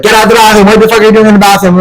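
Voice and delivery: high-pitched imitation of yelling influencers; mock yelling. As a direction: rattle it off like a pushy mock yell. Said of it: "influencers are yelling in my ear".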